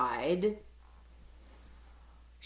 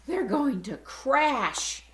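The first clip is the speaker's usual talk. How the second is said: gasping; 8 semitones higher, 6 dB louder, slower than usual. Acted. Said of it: "gasped Stella Luna".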